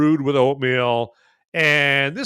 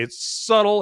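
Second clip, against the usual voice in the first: subtle lifted high and leaned on; It's subtle with subtle stretched and stressed; 7 semitones higher than usual. Shown as a demonstration. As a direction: punch the word subtle. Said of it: "I stress the word subtle".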